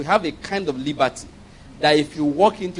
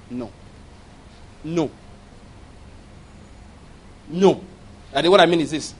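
ordinary talk, nothing marked

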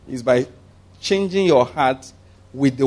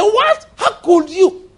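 high, loud shocked squeal; shocked outcry; 5 dB louder, much slower than usual. Acted. People shocked at Peter visiting Gentiles, squealing in disbelief. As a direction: gasp it out in disbelief.

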